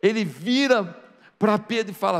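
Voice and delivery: normal talking voice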